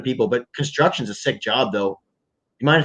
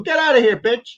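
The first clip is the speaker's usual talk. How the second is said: a boss yelling at a worker on the site, pitched higher; mock angry boss; 8 semitones higher than usual. Foreman catches worker smoking on the job, high mock yell. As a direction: bark it like a boss who caught you slacking.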